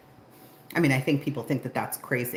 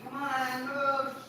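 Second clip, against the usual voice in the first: high, sustained dog call; high, carrying call; 12 semitones higher, much slower than usual. Real, not acted. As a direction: sing out the summons on a high note. Calling dogs in.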